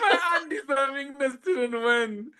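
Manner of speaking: giggling at her own story; laughing. Real, not acted. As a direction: laugh at yourself while saying it. Laughing at herself.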